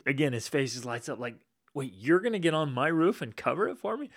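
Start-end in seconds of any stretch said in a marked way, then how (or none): 1.7-4.1 amazed question. Retold or imitated. Face lights up, amazed question.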